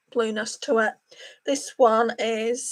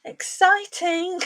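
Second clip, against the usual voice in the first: drawn-out squeal; 8 semitones higher, much slower than usual. Real, not acted. Reacting to the eyeshadow colour again.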